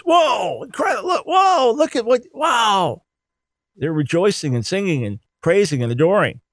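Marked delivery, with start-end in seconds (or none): 0.0-3.0 mock-amazed angels, much higher, gasping whoa; awed angel spectators. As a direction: play angels gaping at creation. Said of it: "they're the spectators".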